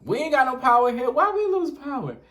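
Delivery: complaining grandma. Retold or imitated. Grandma complaining to granddaughter about the outage, loud high indignant voice. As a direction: fuss like an outraged grandma. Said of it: "And she goes".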